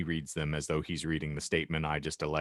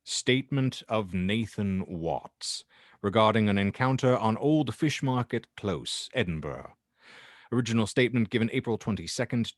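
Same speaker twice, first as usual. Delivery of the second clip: formal statement header read; 3 semitones higher than usual. Acted. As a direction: announce it like a recorded case file.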